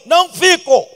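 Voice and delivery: loud, high flat refusal; loud, high emphatic no; firm refusal. Retold or imitated. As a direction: slam the door on the offer. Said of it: "Primeira pergunta".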